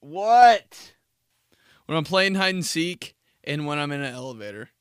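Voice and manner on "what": annoyed groan. Retold or imitated. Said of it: "I'm like".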